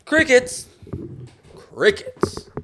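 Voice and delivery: calling out loudly, high pitched; loud and high into the mic. Real, not acted. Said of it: "I am loud as fuck".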